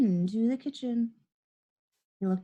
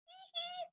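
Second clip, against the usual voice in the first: tiny high-pitched giggle, very soft; sneaky little hee-hee, kept secret; gleeful snicker; 19 dB quieter than usual. A narrator's reading. Henry gloating over the stolen tooth, soft sly snicker. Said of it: "thought Henry".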